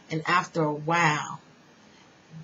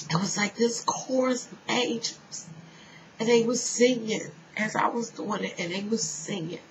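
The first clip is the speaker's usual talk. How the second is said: ordinary talk, nothing marked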